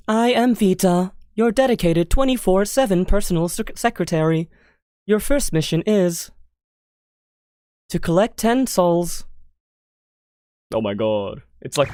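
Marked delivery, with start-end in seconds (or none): 0.1-9.3 lightly voiced AI secretary; a hint of video game computer assistant; formal system voice introducing a mission; GLaDOS. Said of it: "Can I do glados?".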